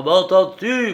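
furious shout. A narrator's reading. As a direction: scream the question in rage. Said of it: "Il tremblait de fureur".

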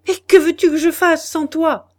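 high, loud anguished cry. Acted. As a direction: cry it out to her in despair. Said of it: "cria-t-il". (cri désespéré, voix haute et forte)

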